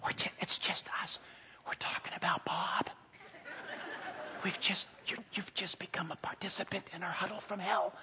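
hushed conspiratorial gossip; secretive, mostly voiceless murmur; whispering. Acted. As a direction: let a listener into the huddle in a soft whisper. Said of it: "if it's being whispered".

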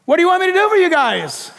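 high, quick holler to the pair; calling out. Acted. Jesus hollering to two blind men, calling out. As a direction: call out the question across a noisy crowd. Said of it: "he called out to them".

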